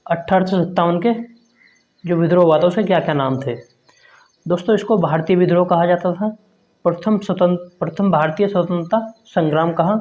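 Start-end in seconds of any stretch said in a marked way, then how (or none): none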